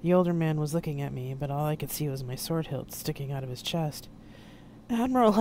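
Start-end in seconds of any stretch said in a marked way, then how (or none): 4.9-5.4 high, loud, upset call; distraught cry. A narrator's reading. Aide kneels by the Admiral after stabbing through him, distraught.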